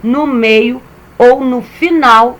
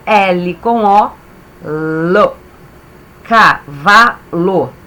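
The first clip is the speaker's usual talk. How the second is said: slow syllable sounding; 2 semitones lower, much slower than usual. Shown as a demonstration. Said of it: "teacher sounds out syllables".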